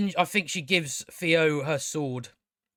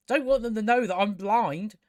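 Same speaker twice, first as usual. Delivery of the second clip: ordinary delivery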